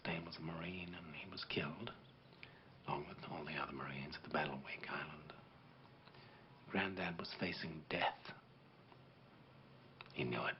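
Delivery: soft grave whisper. Acted. Veteran tells boy of his granddad's death, whispering. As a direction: breathe it out like a secret.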